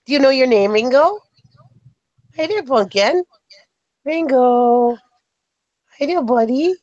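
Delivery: high cooing sing-song; talking to a puppy. Real, not acted. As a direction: coo sweetly at a little dog.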